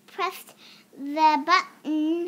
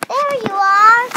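loud, high joyful cry; excited shout; 5 semitones higher, 11 dB louder than usual. Acted. Sally shouting happily as she runs to Bonzo. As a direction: shout it as you run off. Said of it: "Sally shouted".

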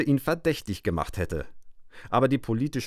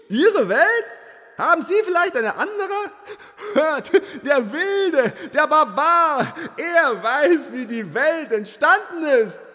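indignant crowd; 13 semitones higher, 4 dB louder, slower than usual. Acted. Outraged crowd of thinking beings.

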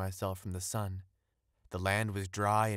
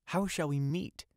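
mild cry, lifted in pitch; 6 semitones higher than usual. A narrator's reading. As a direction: ask it as a higher-pitched exclamation. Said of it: "cried the young fisherman".

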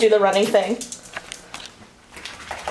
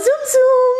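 high squeal; 12 semitones higher, 4 dB louder than usual. Real, not acted. Playful squeal.

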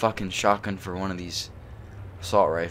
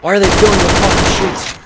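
loud, higher, fast frantic yell; panicked shout; 9 semitones higher, 11 dB louder, faster than usual. Real, not acted. Player sees the streets fill up, frantic loud yell. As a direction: cry out in disbelief at the swarm.

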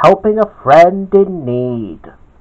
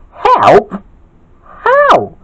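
very high, questioning voice; high, surprised little questions; puzzled asking; 4 dB louder than usual. A narrator's reading. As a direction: ask it in high-pitched puzzlement. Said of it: "asked Gawain".

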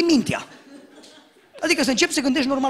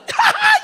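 burst of laughter; 4 dB louder than usual. Real, not acted. Laughing after prank shout.

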